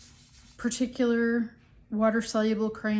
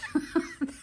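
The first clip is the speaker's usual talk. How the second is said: light laugh; 9 semitones higher than usual. Real, not acted. Laughing at cat-ear sketch.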